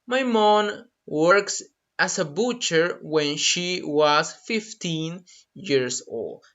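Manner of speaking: slow, careful repetition; drawn-out model sentence; slow repeat. Shown as a demonstration. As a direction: echo it slowly for the class. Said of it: "That's a great example".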